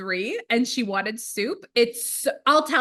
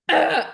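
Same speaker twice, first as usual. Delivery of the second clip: put-on 1 toddler groan; 6 semitones higher, 7 dB louder than usual. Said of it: "she's like".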